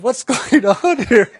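high, loud question breaking into laughter; slow, incredulous, laughing exclamation; laughing bewilderment. Retold or imitated. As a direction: laugh through it in amused disbelief. Amused reaction to the quarrelling souls.

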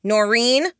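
loud call across the room. Acted. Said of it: "I called out".

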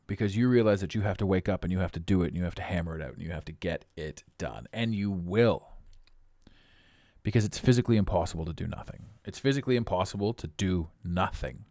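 usual speaking voice

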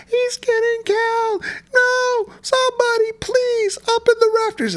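very high, slow, overacted scream-voice; shrill hammed-up damsel in distress; parody panic. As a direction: play a bad actress faking terror. Scary Movie girl parody.

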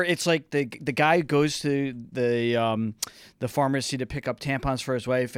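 usual speaking voice

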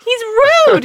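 normal talking voice

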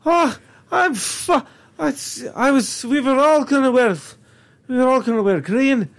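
hammed-up Irish accent as a clurichaun; bad Irish accent. Co-host plays colorblind clurichaun wanting green, bad Irish accent. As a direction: play an exasperated Irishman, badly. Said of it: "the worst Irish accent right now".

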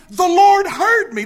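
high, loud exclamation; Jonah. Acted. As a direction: cry it out as a preached testimony. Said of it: "he exclaimed".

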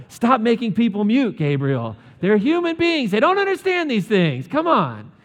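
loud, high exasperated telling-off; hammed-up manager scolding an employee; mock scolding boss.

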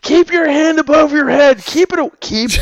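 high, loud mock yell at a kid; mock parent shout. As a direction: bark orders like a frazzled dad, hammed up. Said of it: "I'm just like".

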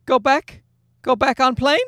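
imitating a pleading toddler. Father voices toddler son's request.

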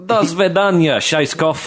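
high, loud mock Russian villain; fake Russian accent. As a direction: bark it like a cartoon Russian. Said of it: "his fake Russian accent".

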